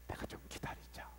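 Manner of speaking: whispered, anger held in; whispered restraint. Acted. Preacher voicing God choosing patience, soft whisper. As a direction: pull back to a hush.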